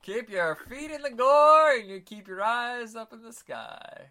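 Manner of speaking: loud, slow, high proclamation; dramatic slogan. Acted. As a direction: boom it like a horror-show host. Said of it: "I'm gonna stop now".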